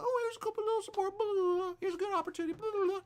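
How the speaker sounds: high, fast mocking voice for a stingy helper; mocking half-hearted donor. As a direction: toss it off in a silly squeaky voice. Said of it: "don't go a little".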